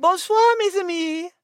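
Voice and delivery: put-on a French-accented purring hello; French diva entrance; French cat greeting. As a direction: greet them grandly in French. Liz makes her entrance.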